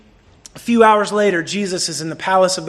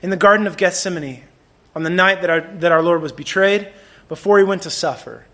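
usual speaking voice

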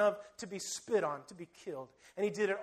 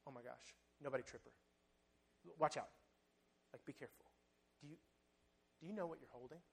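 hushed alarm; 6 semitones lower, 17 dB quieter, faster than usual. Acted. Disciples alarmed at the perfume.

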